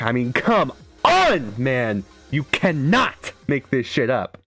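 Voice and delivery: slow, incredulous outburst with big punches on "on" and "not"; can't-believe-it exclamation; emphatic disbelief. Real, not acted. Hyped disbelief at the track.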